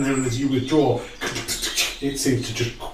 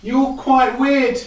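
high slow elf line; 9 semitones higher, slower than usual. Acted.

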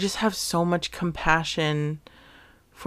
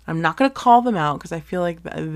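ordinary talk, nothing marked

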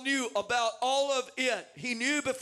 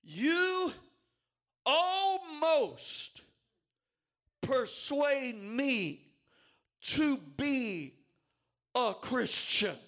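slow, halting, wistful admission; tragic response; 2 semitones higher, much slower than usual. Acted. Agrippa answering Paul with slow tragic regret. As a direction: let each word fall heavy with regret. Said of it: "gives this tragic response".

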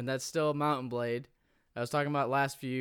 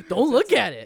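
high and loud through laughter; laughing protest; 10 semitones higher, 11 dB louder than usual. Real, not acted. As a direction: squeal it out mid-laugh. Laughing, embarrassed about shirt.

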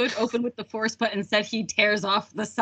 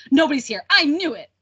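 mock cranky outburst, louder and higher; cranky old Ben; 5 semitones higher, 5 dB louder than usual. Cranky Ben scolding Leia on the mining planet, impatient mock outburst. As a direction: snap it out like an impatient old hermit. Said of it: "just being so impatient".

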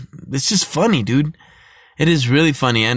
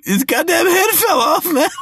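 high, laughing quote; laughing mock panic. Laughing while quoting the partner's freak-out. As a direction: cry it out through laughter. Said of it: "he's like".

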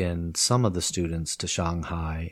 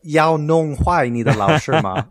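higher, louder playful scold; teasing teacher; 7 semitones higher, 7 dB louder than usual. Retold or imitated. Teacher teasing her cheeky student, playful and loud. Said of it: "She said".

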